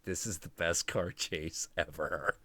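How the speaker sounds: low, hushed amazement; quiet, sunken-voiced reverence; imagined awe. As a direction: say it softly, as if awestruck. Imagined awed viewer reaction.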